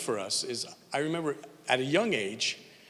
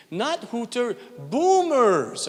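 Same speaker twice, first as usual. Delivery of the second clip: high, louder French-Canadian wife insisting; French accent; 9 semitones higher, 6 dB louder, much slower than usual. Retold or imitated. Husband imitating his French-Canadian wife, French accent. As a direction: correct the word in a French accent. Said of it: "my wife has a French accent".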